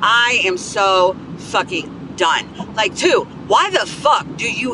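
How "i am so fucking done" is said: very slow, each word spaced out; fed up, done. Real, not acted. Podcaster venting about being cut off, slow and fed up. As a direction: drag it out word by word, utterly done. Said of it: "I'm, I'm done".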